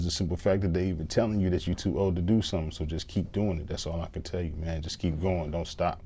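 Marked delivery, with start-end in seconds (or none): none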